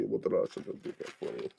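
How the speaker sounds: put-on a light, breathy Russian accent; a quick hint of Russian; little Russian accent. Joking with a friend in a little Russian accent. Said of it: "I did the little Russian accent".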